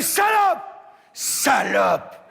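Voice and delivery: high shrieking jealous wife; furious shout. Acted. Wife yelling at her sister in the wardrobe, high furious shriek.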